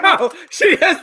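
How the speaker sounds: high laughing protest. Real, not acted. Laughing while correcting who has coffee.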